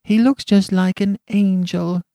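put-on high-pitched childlike voice; small child marvelling, pitched high; charity children. A narrator's reading. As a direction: pipe up like an admiring child. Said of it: "said the charity children".

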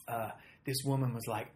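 ordinary talk, nothing marked

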